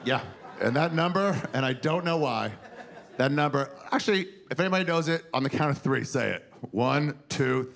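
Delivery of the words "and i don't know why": ordinary talk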